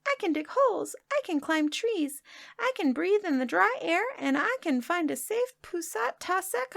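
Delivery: high, small boastful crab voice; tiny crab voice. Acted. Newly made crab boasts to the magician, high tiny voice. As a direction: squeak each boast proudly.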